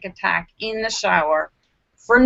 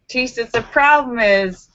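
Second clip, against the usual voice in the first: usual speaking voice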